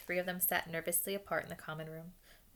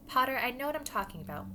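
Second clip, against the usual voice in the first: higher, curt interruption; curtly; 6 semitones higher, 5 dB louder than usual. A narrator's reading. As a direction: cut the pupil off shortly. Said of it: "she said shortly".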